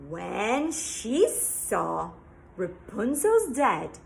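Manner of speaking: usual speaking voice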